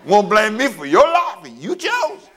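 high, slow mock street talk; God talking tough, street style; playful preacher voicing God in street talk. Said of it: "if God was talking to you in street talk".

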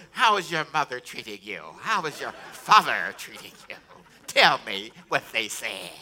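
put-on interrogating grandparent voice; hammed-up grandparent debriefing a grandchild; prying grandparent. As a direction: question the child with mock suspicion. Plays grandparent questioning a grandchild.

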